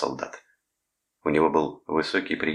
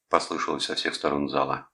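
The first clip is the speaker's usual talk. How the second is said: plain reading voice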